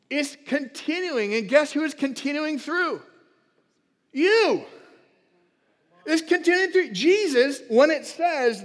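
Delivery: excited revival preaching; fired-up preaching. Acted. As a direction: build the crowd up, preacher-style. Preaching at full force.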